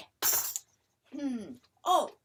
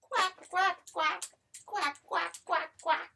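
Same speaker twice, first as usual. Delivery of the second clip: duck quacking; 5 semitones higher, 5 dB louder than usual. Retold or imitated. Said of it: "He says".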